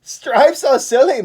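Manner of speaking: loud, high complaining moose voice. Acted. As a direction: scoff at the idea as a cranky moose. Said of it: "Moose complained".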